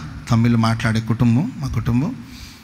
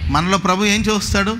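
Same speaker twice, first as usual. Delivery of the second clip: normal talking voice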